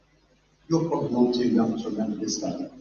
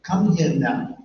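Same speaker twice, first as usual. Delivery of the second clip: put-on parent calling a child in a London accent; 2 semitones higher, 6 dB louder, slower than usual. Shown as a demonstration. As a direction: order a kid over in a London accent. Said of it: "in a London accent".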